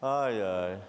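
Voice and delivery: ordinary delivery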